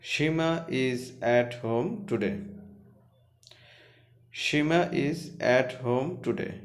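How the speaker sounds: each word said slowly and clearly; slow classroom dictation; slow reading. Shown as a demonstration. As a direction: read it out slowly for students to follow. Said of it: "teacher reads sentence slowly".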